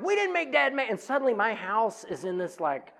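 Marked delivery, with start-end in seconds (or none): 0.0-0.9 put-on very high, loud childish protest; kids protesting. Kids answering mom who yells at them, high and loud. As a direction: squeal it like a defensive kid.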